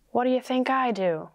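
plain reading voice

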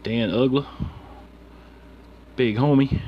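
usual speaking voice

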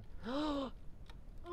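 high, soft gasp; sharp high intake at something cute; delighted gasp. Real, not acted. Gamer spots a little kitty, delighted gasp. Gasps on spotting a little kitten.

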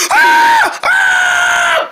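very high, loud yelps; sudden piercing cry of pain; pained scream. Acted. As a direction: shriek as if burnt. Burnt by the fire.

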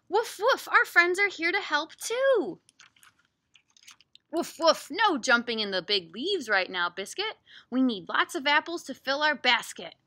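loud, animated storybook read; big swooping lines with playful dog woofs; reading to children. Acted. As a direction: project the story to little ones. Reads picture book aloud.

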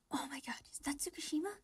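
whispering. Real, not acted. Gasping in delight.

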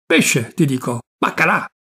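ordinary delivery, nothing marked

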